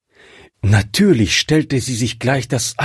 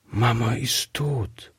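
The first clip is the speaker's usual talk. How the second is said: put-on softer, hushed childlike voice; whispered; 6 dB quieter than usual. A narrator's reading. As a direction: breathe the news out quietly. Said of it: "antwortete Gretchen flüsternd".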